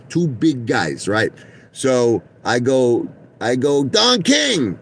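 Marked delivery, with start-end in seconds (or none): 3.8-4.8 high excited call across the hall. Retold or imitated. Fan calling out to Don King in hotel, high excited call. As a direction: shout his name to get his attention.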